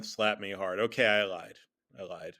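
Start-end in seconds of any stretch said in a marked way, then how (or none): none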